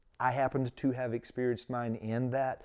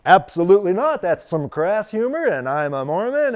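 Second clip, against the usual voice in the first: indignant refusal; 7 semitones higher, 12 dB louder than usual. Retold or imitated. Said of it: "He's like".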